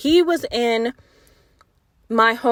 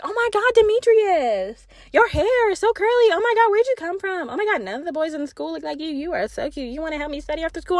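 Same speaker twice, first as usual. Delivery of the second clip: put-on much higher, gushing girly voice speeding up; fawning girls; 6 semitones higher than usual.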